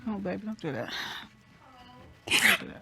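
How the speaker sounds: soft unvoiced plea to stop; whispered comfort. Real, not acted. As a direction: hush her tenderly. Comforting crying daughter.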